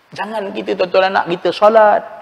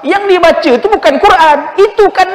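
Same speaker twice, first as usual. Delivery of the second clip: high, loud snap back; hammed-up mother scolding; mock angry mother; 12 semitones higher, 6 dB louder than usual. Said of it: "Mak dia marah".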